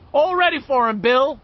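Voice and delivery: high, loud call; eager shout to the leader; crying out. A narrator's reading. Stan calling to Bill, ready and loud. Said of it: "Stan cried".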